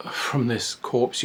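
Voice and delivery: ordinary talk, nothing marked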